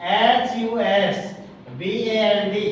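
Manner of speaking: slow, each letter of the word read out separately; teacher spelling it out for the class, slow and separate; spelled letter by letter. Shown as a demonstration. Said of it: "teacher spells the word letter by letter".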